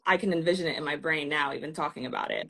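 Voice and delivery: ordinary talk, nothing marked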